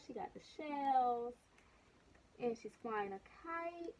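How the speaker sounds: usual speaking voice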